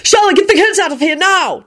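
high, urgent cry; shouted. A narrator's reading. Family member getting kids away from gore, shouting. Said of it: "Jamie shouted".

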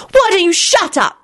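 high-pitched, contained yell; yelled retort. A narrator's reading. Timothy answering Jamie back, yelling. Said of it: "yelled Timothy".

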